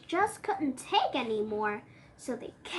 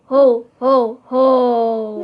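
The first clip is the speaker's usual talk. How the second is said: Santa laugh; 8 dB louder, slower than usual. Acted.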